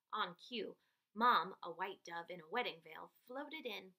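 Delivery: ordinary delivery, nothing marked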